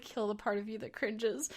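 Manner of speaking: a soft inner mantra; quiet self-pep talk. Real, not acted. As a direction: talk yourself through the cringe under your breath. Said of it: "I'm like".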